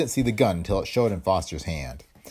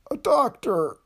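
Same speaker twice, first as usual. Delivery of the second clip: mumbled; slower than usual. A narrator's reading. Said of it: "he mumbled".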